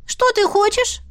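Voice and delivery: high, wary squeak; grudging little animal; squeaky otter voice. A narrator's reading.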